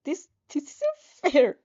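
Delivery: a slow, high, hurt whimper. Acted. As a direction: let it wobble out, slow and hurt. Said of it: "you whimper".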